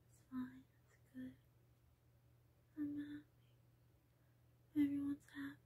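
breathy whisper to herself; barely voiced, just air. Real, not acted. Whispering to herself about everyone being happy. Quiet self-talk turns whispered.

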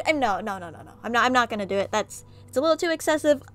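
ordinary talk

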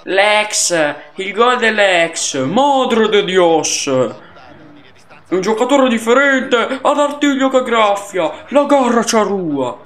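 Lele Adani goal scream. Acted. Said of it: "oggi sto in mood Lele Adani".

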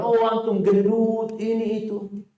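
high, slow taunting voice; mocking insult. Preacher mimics a body shamer's taunt, high and mocking. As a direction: drag out the jibe like a bully. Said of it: "Ketika dia mengatakan".

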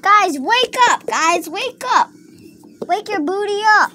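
high, urgent calling; pretend wake-up call. Toy waking everybody else up, high urgent call. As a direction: call out high to rouse sleepers.

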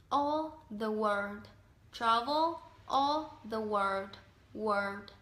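slow model phrases, "world" with R and D; careful world pronunciation. Shown as a demonstration. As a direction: demonstrate slowly, landing carefully on "world". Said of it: "mà phải đọc là world".

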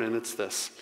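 usual speaking voice

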